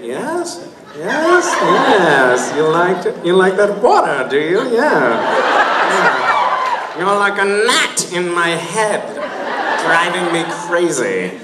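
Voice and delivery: high, nasal-placed mock accent, hammed up; Dark Empty accent. Panel asked to hear the accent, hammy mock accent. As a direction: camp up the made-up accent for the fans. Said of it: "Let's hear a little bit of it".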